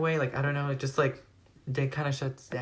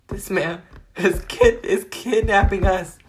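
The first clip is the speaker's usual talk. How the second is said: higher, louder, slowed alarm; mock panic; 6 semitones higher, 7 dB louder than usual. Teen recounting the kidnapping to viewers, mock panic. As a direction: stretch out the word in alarm.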